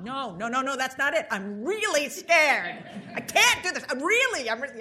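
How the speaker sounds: loud, high acted panic, shouting out the fear; re-enacted panic. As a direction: act out a frightened outburst at full volume. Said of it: "And I was scared".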